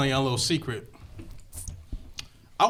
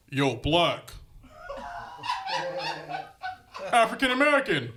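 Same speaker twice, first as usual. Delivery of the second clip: a lightly put-on street voice with a laugh; different person; 7 semitones higher than usual. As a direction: switch persona, then correct yourself. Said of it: "I'm like a different person".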